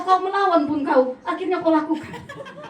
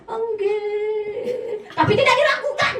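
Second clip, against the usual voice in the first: mock sing-song no; 8 semitones higher, 3 dB louder, slower than usual. Mocking hypocrite voice.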